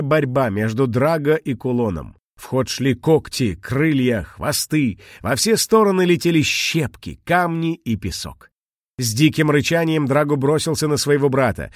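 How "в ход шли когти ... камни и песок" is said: usual speaking voice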